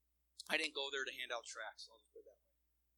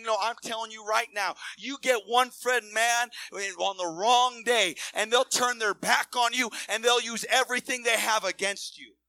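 loud, driving sermon warning; fiery preaching; 5 semitones higher, 13 dB louder than usual. Acted. Preacher warning the congregation, loud and driving. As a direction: hammer the warning home to the crowd.

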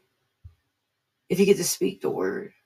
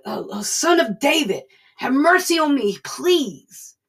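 higher-pitched, rising imitated plea; acted pleading; 7 semitones higher, 4 dB louder than usual. Blind man begging Jesus for mercy, acted desperate pleading. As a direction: beg for mercy as the blind beggar.

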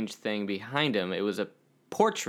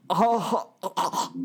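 high mock "oh" gasps; mock gaping gasps; 9 semitones higher, slower than usual. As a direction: gasp as if the bite won't fit. Mimics struggling with giant hamburger.

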